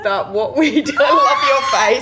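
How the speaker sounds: breaking into laughter mid-sentence; laughing. Real, not acted. Laughing at listener's reaction.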